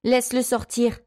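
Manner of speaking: put-on high-pitched insistent girl; demanding. A narrator's reading. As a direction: demand it as a defiant child. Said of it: "exigea-t-elle".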